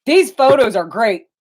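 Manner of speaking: loud, exasperated dad voice. Retold or imitated. The dad tells the mom to just take the photos, loud and exasperated. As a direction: lay down the law at his wife. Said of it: "he goes".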